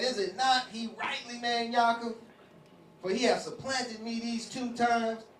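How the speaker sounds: high, loud bitter complaint; aggrieved Esau. Retold or imitated. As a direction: protest being cheated twice. Esau's angry complaint voiced.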